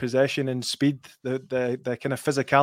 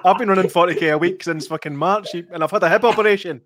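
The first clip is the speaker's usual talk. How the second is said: laughing; 5 semitones higher, 6 dB louder than usual. Real, not acted. Joking about own running.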